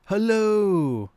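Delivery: a bad imitation of the fish seller's greeting; Dan's Fish impersonation. Streamer doing a poor imitation of Dan greeting. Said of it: "That's my Dan's Fish impersonation".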